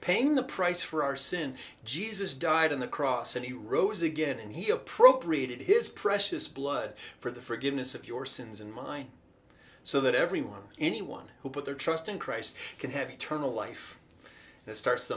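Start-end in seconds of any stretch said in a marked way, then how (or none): none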